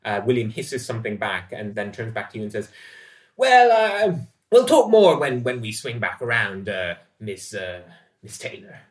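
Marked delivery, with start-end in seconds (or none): 3.4-8.8 boastful sailor. Acted. William backing off from Dorothy: loud start, trailing away. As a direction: start grand, then lose steam. William takes his leave.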